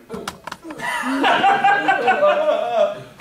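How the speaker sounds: burst of laughter. Real, not acted. Laughing at own story.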